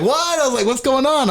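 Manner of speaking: high, startled disbelief; baffled what. Retold or imitated. Host baffled by drunk friend's outburst, high startled disbelief. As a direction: squeak it out in confusion.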